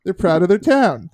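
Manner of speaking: John Candy. Retold or imitated. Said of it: "To quote John Candy".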